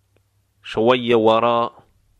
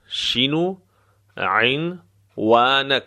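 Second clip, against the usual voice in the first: شینو lifted, pause, then very drawn-out عنوانک; slow, broken up; slower than usual. Shown as a demonstration. Teacher breaking down the address question, slow and broken up. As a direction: dictate it piece by piece, very slowly. Repeats phrase slowly in pieces.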